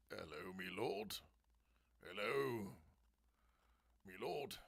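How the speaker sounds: low, soft put-on servant voice; low servile greeting, played for fun; mock servant. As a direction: greet a lord with hushed mock deference. Greets Lord Brownie as servant.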